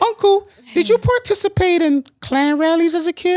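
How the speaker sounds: put-on high, innocent Jamaican kid asking; a ten-year-old Jamaican nephew questioning his uncle; Jamaican child accent. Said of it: "my Jamaican ten-year-old accent".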